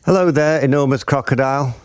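high, lilting little bird voice; singsong bird. A narrator's reading. Bird greeting the crocodile, high singsong. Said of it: "sang the roly-poly bird".

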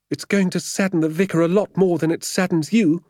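very high, loud complaining woman; aggrieved vicar's housekeeper. A narrator's reading. Woman answering sergeant about the vicar's disappointment, aggrieved. Voices Missus Clipstone.

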